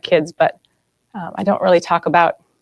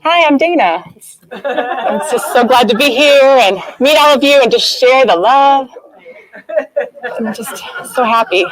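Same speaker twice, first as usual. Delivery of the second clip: higher, sugary, over-the-top friendly self-introduction with a laugh; fake cheerful ego; 6 semitones higher than usual. The ego introducing itself to a room, gushing with fake cheer. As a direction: gush like someone hiding fear behind niceness. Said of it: "this is literally how you go about your day".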